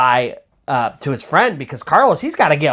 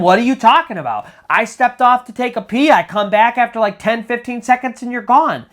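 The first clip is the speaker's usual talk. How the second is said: high-pitched indignant retort, retold; yelling back; 9 semitones higher, 4 dB louder than usual. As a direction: act out the friend yelling back in self-defence. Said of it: "Carlos starts yelling at him".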